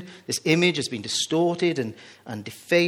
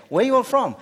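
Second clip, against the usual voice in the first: higher, louder American waiter voice; American accent; 10 semitones higher, 7 dB louder than usual. Retold or imitated. Preacher repeats the American question in a loud accent. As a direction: ham up the American twang. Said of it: "I don't do American accent, but that's my best".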